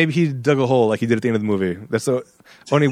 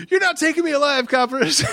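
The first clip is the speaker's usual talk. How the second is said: high-pitched mock defiance; hammed-up crook taunting the police; defiant cornered gunman; 11 semitones higher than usual. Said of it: "his stance was".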